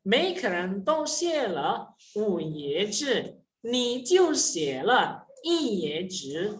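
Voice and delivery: slow, careful model sentence; slow demo reading. Shown as a demonstration. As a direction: spell it out slowly for learners.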